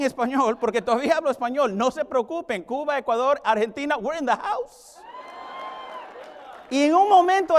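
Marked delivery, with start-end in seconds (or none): none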